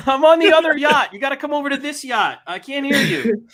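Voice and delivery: very high, sustained pretend holler; mock distant call. Rich guy summoning someone to his yacht, high mock calling. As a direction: sing it out as if across the water.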